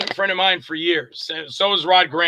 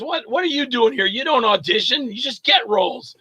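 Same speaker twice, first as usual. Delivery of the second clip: higher-pitched mock indignation; incredulous Nick; 6 semitones higher than usual. Director scolding an actor for auditioning, mock incredulous. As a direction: imitate an exasperated director scolding a favorite.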